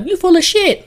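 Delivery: very high mock female voice; mimicked skeptical woman. Woman calls his bluff over a drink, mock scoffing. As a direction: imitate her calling his bluff up high.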